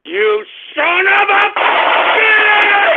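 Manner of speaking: high furious screaming. Real, not acted. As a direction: scream it at the troll with everything. Host screaming at prank caller.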